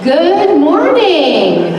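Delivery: loud, high, drawn-out good morning; big greeting. Real, not acted. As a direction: call it out to the whole room. Pastor greets the congregation loudly.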